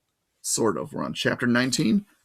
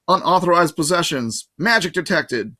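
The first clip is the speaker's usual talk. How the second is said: alarm voice; 5 semitones higher, 4 dB louder than usual. A narrator's reading. Metal detector alarm announcing.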